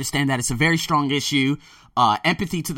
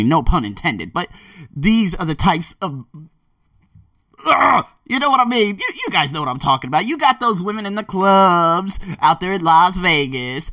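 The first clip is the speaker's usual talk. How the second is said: ordinary talk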